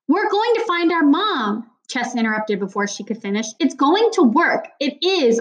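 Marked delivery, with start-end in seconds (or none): 0.1-1.7 higher pitched, slow and urgent; shouted. A narrator's reading. Chess shouting at Natalie, slow raised insistence. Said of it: "he really did shout at her". 3.6-5.4 higher, slow raised insistence; shout. A narrator's reading. Chess shouting his certainty at Natalie, raised. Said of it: "he really did shout at her".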